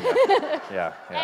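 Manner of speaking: shrieking laugh, very high and loud. Real, not acted. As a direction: squeal it through laughter. Laughing at the story.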